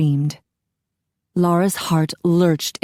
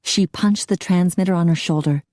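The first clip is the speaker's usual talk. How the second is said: ordinary delivery, nothing marked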